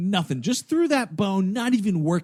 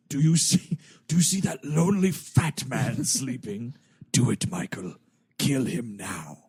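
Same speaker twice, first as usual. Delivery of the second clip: menacing villain voice; 6 semitones lower than usual. Retold or imitated. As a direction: urge him on like a devil. Villain urging a kill.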